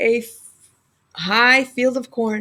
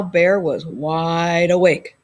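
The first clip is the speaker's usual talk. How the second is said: ordinary delivery, nothing marked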